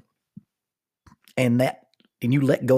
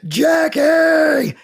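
a long, high, loud bellow held out; 9 dB louder than usual. Retold or imitated. Stuck hanging in the rope, bellowing for his wife: a long, loud bellow. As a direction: bellow the name with all he has left. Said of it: "I am now bellowing".

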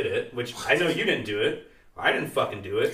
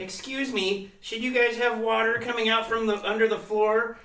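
much higher, prissy mock customer voice; mocking the lady; 9 semitones higher than usual. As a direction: mimic the clueless lady, pitched way up. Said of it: "this lady was like".

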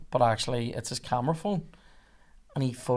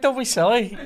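higher-pitched imitation, just out of laughter; laughing reassurance; 9 semitones higher, 3 dB louder than usual. Police operator to worried caller, amused dismissal. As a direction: brush it off with amusement. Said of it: "she's like".

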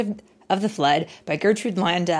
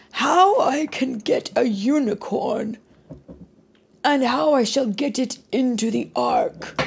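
Father Noah; 8 semitones higher, 3 dB louder than usual. Acted. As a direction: muse aloud as a worried Father Noah. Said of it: "he said".

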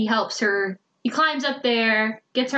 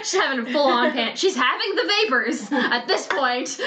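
laughing while talking; giggling through the words; 3 semitones higher than usual. Real, not acted.

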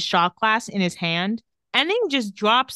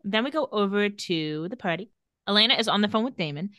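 usual speaking voice

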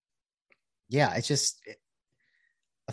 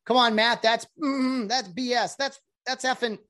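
heckling fan; 9 semitones higher than usual. Retold or imitated. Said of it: "when he yells at your name".

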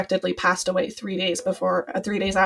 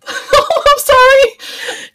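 much higher, louder and slow, voice cracking with tears; laughing through tears, choked with emotion; tearful apology; 10 dB louder, much slower than usual. Real, not acted. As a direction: apologize while welling up. Guest tearing up after thanks.

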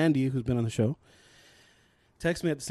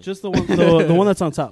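raised voice over the room; loud directions to a friend; calling out; 3 semitones higher, 11 dB louder than usual. Real, not acted. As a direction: call it across to a friend. Pointing Jay to a beer.

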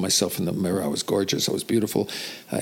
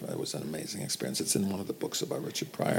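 trailing off; 9 dB quieter than usual. Real, not acted. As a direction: voice fading to near whisper. Memory of stopping Pryor.

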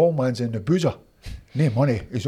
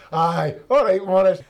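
louder, higher mock-hearty "yeah, right"; disbelieving brush-off; 6 semitones higher, 5 dB louder than usual. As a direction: humour a suspected prank caller. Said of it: "I thought it was Morris Johnston winding me up".

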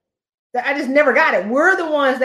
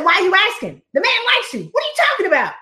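high, exasperated disbelief; incredulous coach; 8 semitones higher, faster than usual. Retold or imitated. Coach scolding a client who keeps selling herself, high and incredulous. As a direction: pitch it up in disbelief. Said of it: "I said".

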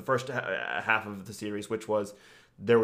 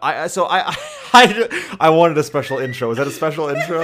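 laughing; 4 semitones higher, 10 dB louder than usual. Real, not acted. Laughing at his own intro.

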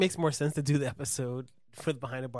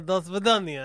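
put-on Russian accent; 5 semitones higher, 7 dB louder than usual. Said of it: "It sounds like you're, like, saying".

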